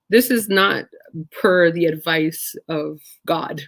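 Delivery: usual speaking voice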